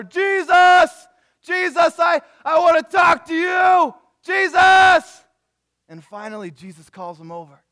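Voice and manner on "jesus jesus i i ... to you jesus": full-force, high, desperate acted yell; louder, angrier shouting. Angrier blind man shouting over the crowd to Jesus, louder yelling. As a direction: bellow the name at the top of your voice. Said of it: "and he gets louder".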